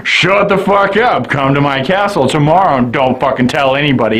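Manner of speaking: lightly voiced menacing baron, low and fast at the end; a hint of a sinister nobleman; creepy baron.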